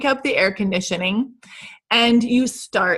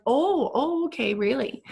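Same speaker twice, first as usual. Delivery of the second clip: surprised relatives; 3 semitones higher, 5 dB quieter than usual. Retold or imitated. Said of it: "they're like".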